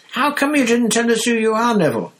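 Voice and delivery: very high, loud eager question; beaming, bursting to know; high-pitched excited ask; excited Stan. Acted. Stan voiced.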